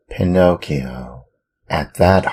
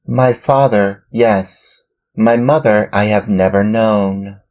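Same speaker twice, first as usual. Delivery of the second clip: put-on higher, louder puppet boy voice; a polite little wooden boy; Pinocchio; 7 semitones higher, 8 dB louder than usual. Pinocchio answers.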